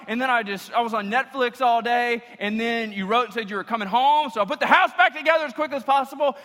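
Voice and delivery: high, loud and breathless, rattling it off; wife rattling off her day to husband, high and giddy; giddy mock wife. Wife voiced in breathless mock account.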